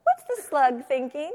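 high, loud, drawn-out question rising at the end; big storytime wondering; teacher's prompting question. Shown as a demonstration. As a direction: ask it slowly with exaggerated curiosity. Demonstrating a question to children.

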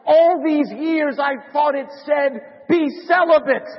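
high, slow, anguished punchline; screaming out. Acted. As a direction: wail it slowly as the horrified monk. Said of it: "Brother Andrew screams out".

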